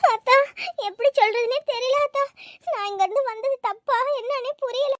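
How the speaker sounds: tearful, confused confession; crying. Acted. Daughter unsure how to tell mother, crying. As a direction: struggle to explain through tears. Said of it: "இப்ப அழுதுகிட்டு கிடக்குற".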